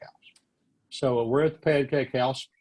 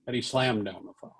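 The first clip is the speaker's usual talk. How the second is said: usual speaking voice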